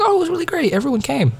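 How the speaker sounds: very high-pitched mimicked answer; retold cheery reply. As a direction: imitate a friend up in a high squeaky register. Voicing friends' replies after Thanksgiving.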